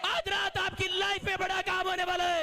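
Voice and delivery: high, loud, shouted preaching; preaching at full force. Acted. Preacher urging the congregation to laugh in joy. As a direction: fire up the church to rejoice.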